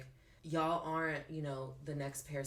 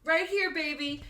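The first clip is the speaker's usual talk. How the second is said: high, loud sassy drag-queen flourish; 11 semitones higher, 10 dB louder than usual. Acted. To the podcast host asking where to find her, loud high sass. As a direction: serve it to the audience with attitude.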